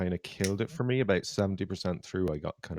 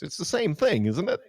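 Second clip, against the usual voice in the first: high, louder mock American dude voice; 9 semitones higher, 5 dB louder than usual. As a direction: put on a hammed-up American guy.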